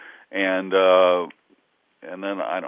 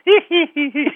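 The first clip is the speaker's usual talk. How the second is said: high, sneaky hee-hee giggle; mischievous giggle; 7 dB louder than usual. Retold or imitated. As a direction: snicker like a troublemaker in the corner. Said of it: "Will's going".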